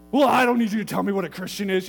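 retold indignant retort. Caller rebuffs the pastor's correction, loud mock indignation. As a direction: act out an offended man pushing back.

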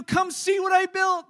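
put-on high, slow, loud child's plea; excited kid. Son urging his dad to come see what he built, high excited. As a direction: beg like an eager little boy. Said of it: "He's, you know".

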